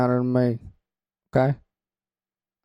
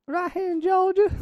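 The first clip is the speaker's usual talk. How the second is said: much higher put-on Southern twang; high mock Georgia accent. Host chiming in as a Southern woman, pitched way up. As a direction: squeal it like a proud Georgia local. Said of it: "you a Southern woman".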